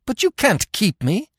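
high, loud cry of protest; alarmed, high-pitched outburst. A narrator's reading. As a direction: cry out against your captor. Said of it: "she cried".

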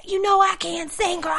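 high, put-on sick voice; hammed-up laryngitis excuse; faked laryngitis. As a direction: act like you've lost your voice. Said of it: "play like I had laryngitis".

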